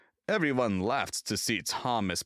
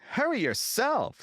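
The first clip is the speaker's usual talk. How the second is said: cheeky retort. A narrator's reading. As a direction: talk back to the big engine. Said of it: "said cheeky Thomas".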